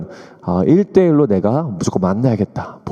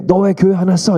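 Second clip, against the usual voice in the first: mild mock telling-off, higher voice; retold scolding; 8 semitones higher than usual. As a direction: imitate a scolding parent, pitched high. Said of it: "이러면서 혼내기 시작하는 거예요". (꾸짖는 부모 흉내)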